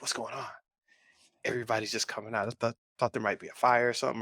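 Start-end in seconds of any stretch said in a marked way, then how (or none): 0.0-0.5 whispered. Retold or imitated. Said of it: "I'm like".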